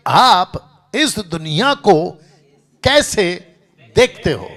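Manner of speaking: loud, drawn-out sermon question; loud slow reading. Shown as a demonstration. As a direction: read the slide loud and slow, word by word. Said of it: "मैंने बताया था ये वाला चित्र".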